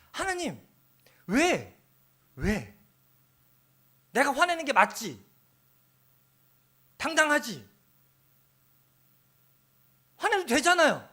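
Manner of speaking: high, loud complaint to God; indignant prayer. Retold or imitated. Pastor voicing his own indignant prayer, high and loud. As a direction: demand God's agreement, indignant. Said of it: "가끔 이런 기도도 하거든요".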